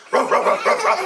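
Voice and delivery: breathy sniffing barks; eager dog sniffs. Acted. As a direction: sniff and bark like a hungry dog. Dog sniffing the market smells.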